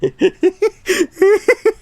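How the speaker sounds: squealing high laugh. Real, not acted. Streamer cracking up at his own joke, high laugh.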